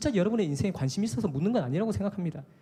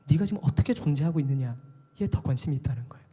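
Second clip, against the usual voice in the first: ordinary talk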